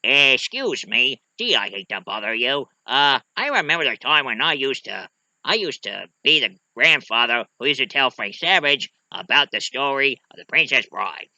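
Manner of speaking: slurred, halting character impression; Peter Falk. Storytelling grandfather politely interrupting, slurred impression. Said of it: "it was all told by Peter Falk".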